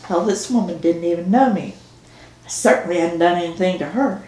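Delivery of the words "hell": plain reading voice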